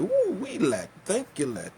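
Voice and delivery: high whooping delight; excited whoop. Retold or imitated. Man lit up on spiked tobacco, whooping happily. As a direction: whoop it up like a happy smoker.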